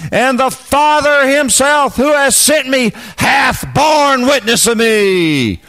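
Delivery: high-pitched, drawn-out pulpit proclamation; full-force preaching. Real, not acted. Preacher proclaiming God as witness to the congregation, full-force preaching. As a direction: preach it out, stretching the weighty words slowly.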